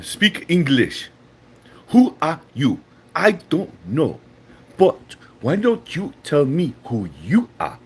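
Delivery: hammed-up African accent with swooping pitch; mock African accent. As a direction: put on a measured, emphatic African accent. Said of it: "read it how your picture looks".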